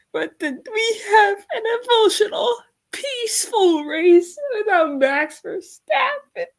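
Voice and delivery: high, emotional, tearful voice; happy crying. Real, not acted. Streamer moved by Ferrari keeping the lead, tearful. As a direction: gush through happy tears. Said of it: "this race still made me cry".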